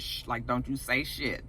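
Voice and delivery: low, soft shushing aside; retold hush. Lady May warning Zora's mama to keep quiet, hushed. Hushing someone in retold scene.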